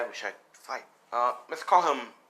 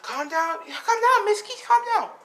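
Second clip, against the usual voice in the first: very high, loud pleading call; acted frantic intervention; urgent calming shout; 5 dB louder, slower than usual. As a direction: break up a fight, voice pitched way up. Friend calling out to break up fight.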